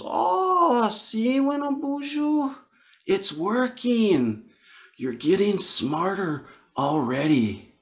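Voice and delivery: put-on high, slow, sly old-woman voice; teasing grandmother. Grandmother teasing the boy who called the berries poop, high and slow.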